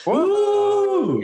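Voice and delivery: high, excited cheer; excited whoop. Real, not acted. Cheering on a friend's secret new show, an excited whoop. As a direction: whoop it up in delight.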